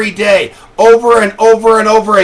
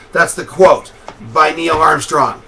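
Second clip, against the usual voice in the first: normal talking voice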